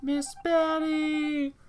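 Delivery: very high, slow sing-song call; high drawn-out call. Pretend. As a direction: stretch it out up high. Calling out a player's name.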